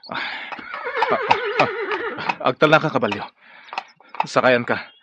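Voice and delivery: high yelps, then loud calls to the horse; loud shouts urging a horse; calling to the horse. Acted. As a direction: spur the horse on with loud cries. Rider urging his horse on.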